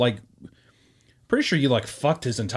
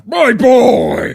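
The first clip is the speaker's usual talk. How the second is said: shouted Disney line; 10 semitones higher, 10 dB louder than usual. Pretend. Hercules quote shouted.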